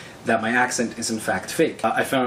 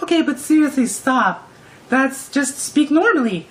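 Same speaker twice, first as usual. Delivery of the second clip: high, louder mock-girl voice; put-on high female voice, insisting; imitated insistent girl; 14 semitones higher, 5 dB louder than usual. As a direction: plead like an exasperated girl, exaggerated. Imitating girl demanding he stop.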